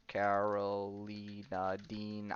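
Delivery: slow, low and quiet, stretching each syllable; drawn out while typing. Real, not acted. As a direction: sound the name out slowly as you type it. Typing a name to look up.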